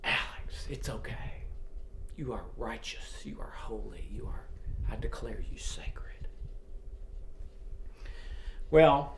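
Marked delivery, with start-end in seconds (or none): 0.0-6.3 whisper. Pretend. God whispering to Alex after his sin, softly. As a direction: whisper love to a guilty soul.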